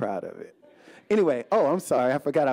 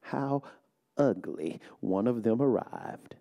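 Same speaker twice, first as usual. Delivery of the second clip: slow, soft, measured poem reading; poem recitation; 2 semitones lower, 4 dB quieter, slower than usual. Acted. Poet reading her poem to a hushed audience, slow and soft. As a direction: let each line settle, quiet and deliberate.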